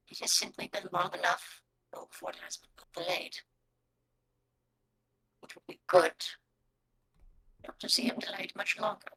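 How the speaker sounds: low, very soft gravelly creature, half whispered. Acted. Game master voices a wasp only you understand, gravelly voice. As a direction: rasp it out quietly and low, with formal courtesy. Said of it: "You hear the gravelly voice go".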